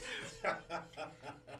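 real high laughter; laughing. Real, not acted. Laughing at the joke.

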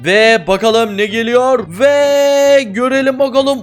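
drawn-out, high and rising anticipation; slow, high build-up before a prize; suspenseful reveal. Real, not acted. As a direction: stretch the words like a game-show reveal. Suspense before opening the loot case.

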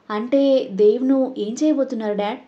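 ordinary delivery

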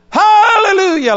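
sudden piercing shout of praise; imitated shout. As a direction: belt it out like a startled worshipper. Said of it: "like that".

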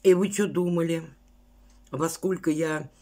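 talking with food in mouth; chewing while talking. Real, not acted. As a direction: mouth partly full, chewing. Seen 2: Bite at -9, chewing before; closed lips and working mouth mid-phrase.

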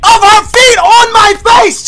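loud, high disbelieving shout; shocked laughter. Real, not acted. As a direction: yell it, stunned and amused. Disbelief after being stomped.

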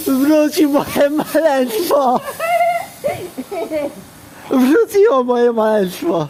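high, slow joyful cry with laughter; overjoyed. Real, not acted. Train returns, vlogger overjoyed. (radosny okrzyk)

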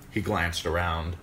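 plain reading voice

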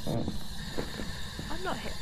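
slow breathy ghostly whisper; creepy whisper. Pretend.

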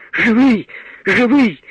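high, loud cry of relief; relieved cry. A narrator's reading. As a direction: gasp it out in relief. Mother finds son alive. (мати з полегшенням кричить над сином)

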